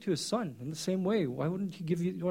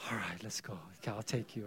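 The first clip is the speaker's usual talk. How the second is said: soft giving in; 8 semitones lower, 11 dB quieter than usual. Retold or imitated. Dad giving in to son.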